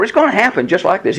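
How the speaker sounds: ordinary talk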